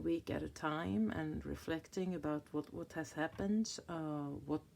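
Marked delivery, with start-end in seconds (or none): none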